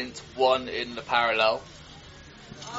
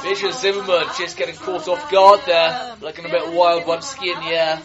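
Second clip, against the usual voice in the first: excited commentary; 4 semitones higher, 8 dB louder than usual. Real, not acted. Racer nearly falls at jump.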